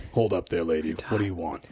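low, gruff bouncer stopping her; gruff guard voice. Acted. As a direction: growl like a club bouncer.

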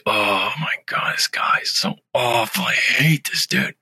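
slow hushed whisper. Retold or imitated. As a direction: say it under your breath, disgusted. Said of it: "He's like".

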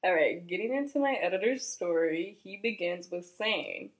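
ordinary delivery

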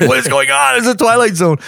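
high bewildered mock thought; bewildered disbelief. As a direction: sound baffled, as if in the Twilight Zone. Bewildered thought about the happy officer.

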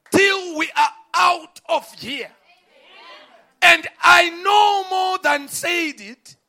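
preacher crying out the prophet's words; preaching at full force. Real, not acted. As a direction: command the storm from the pulpit.

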